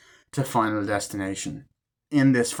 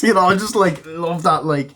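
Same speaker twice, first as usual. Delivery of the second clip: laughing, higher and louder; real amused laugh; 5 semitones higher, 4 dB louder than usual. Real, not acted. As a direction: laugh through the words.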